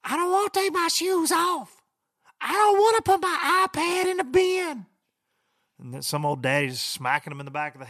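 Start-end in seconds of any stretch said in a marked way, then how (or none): 0.0-4.9 put-on high, loud bratty child whine; squeaky tantrum child; whiny kid voice. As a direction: whine like a spoiled kid at security. Whiny kids at airport security.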